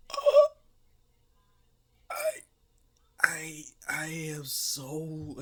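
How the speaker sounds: high, slow, whispered stammer of shame; ashamed stammer. Acted. Boy hiding his shame, slow stammering whisper. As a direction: stumble over the words in shame. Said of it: "As he's".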